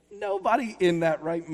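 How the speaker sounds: ordinary delivery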